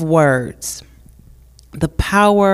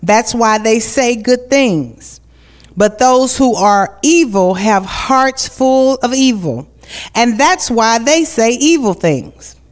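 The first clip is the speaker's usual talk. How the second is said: raised, slow preaching cadence; 4 semitones higher, much slower than usual. Acted. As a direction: preach it from the pulpit. Scripture then slow preaching.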